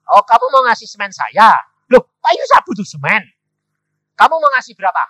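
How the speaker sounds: high, forceful acted questioning; loud challenge. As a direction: challenge him at full volume. Challenging the man offering cement.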